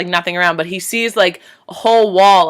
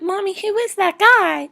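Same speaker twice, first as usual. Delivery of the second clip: put-on little girl voice; 12 semitones higher than usual. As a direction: ask like a curious small child. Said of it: "the little girl's like".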